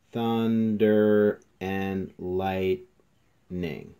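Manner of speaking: syllable by syllable. Shown as a demonstration. Teacher checking a haiku's five syllables, "Thunder and lightning" syllable by syllable. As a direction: THUNDER AND LIGHTNING drawn out, each syllable separate and loud. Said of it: "Let's see if that's correct".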